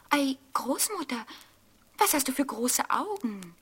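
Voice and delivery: put-on a curious child asking; little girl voice. A narrator's reading. Rotkäppchen voice. (Rotkäppchen fragt erstaunt mit hoher Mädchenstimme)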